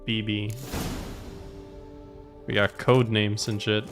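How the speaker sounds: usual speaking voice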